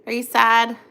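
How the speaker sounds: ordinary talk, nothing marked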